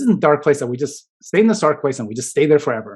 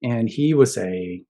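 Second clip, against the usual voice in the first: usual speaking voice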